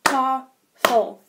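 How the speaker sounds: "Tafel" split into two separate syllables; "Tafel" with a clear gap between beats; broken into syllables. Shown as a demonstration. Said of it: "check how many syllables it has".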